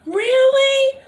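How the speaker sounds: very high mock-disappointed voice; imitated plaintive mom; dismayed mom. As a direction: squeak it up like a hurt mother. Mom's dismayed reply, retold.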